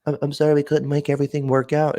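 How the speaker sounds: high nerdy apologetic voice; hammed-up dweeb saying sorry; mock geeky caller. Mocking the geeky coordinator's apology, high nerdy voice.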